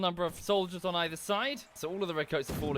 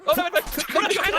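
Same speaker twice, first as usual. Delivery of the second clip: much higher and louder dismay; real laughing dismay shout; excited shout; 9 dB louder, much faster than usual. Real, not acted. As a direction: yell as a teammate goes down. Cheering on a falling fighter.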